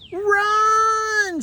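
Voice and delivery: very high alarmed shout; shouting Run. Acted. Lou warns the mice of the cat, very high shout. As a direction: scream a warning to flee. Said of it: "shouted Lou".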